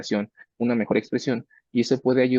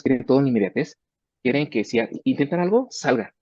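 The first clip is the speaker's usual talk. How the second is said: normal talking voice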